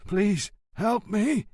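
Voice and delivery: slow, strained plea; faked choking, slow and pleading; wheezed. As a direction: squeeze the words out like you can't breathe. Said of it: "wheezed Montalbano".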